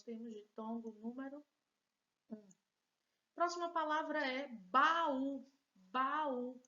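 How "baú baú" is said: baú loud, stretched as ba-ú; separated vowels. Shown as a demonstration. Teacher showing that vowels separate, baú loudly split. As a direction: punch baú in two beats, ba-ú. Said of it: "Vejam que elas se separam".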